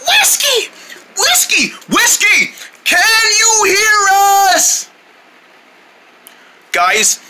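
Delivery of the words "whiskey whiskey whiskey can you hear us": high, drawn-out searching call. Acted. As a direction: call for a missing friend. Searching for missing Whiskey.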